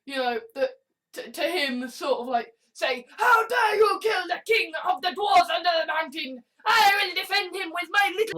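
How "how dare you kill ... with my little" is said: high-pitched, shouted fan indignation; hammed-up defender of the king; mock outrage. Fan defends the dwarf king, mock outrage.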